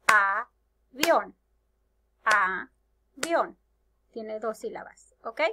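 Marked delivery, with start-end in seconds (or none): 0.1-3.6 avión split as A... vión, slow; slow, separated A - vión with a clear gap; syllable by syllable. Shown as a demonstration. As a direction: clap out avión in two beats. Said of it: "las voy a aplaudir".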